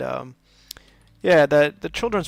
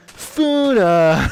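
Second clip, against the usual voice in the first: playful call; 5 semitones higher, 7 dB louder than usual. Pretend.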